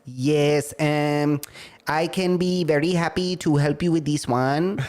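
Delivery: put-on polite Filipino-accented agent; Manila call-center accent. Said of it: "call center in Manila where somebody could say".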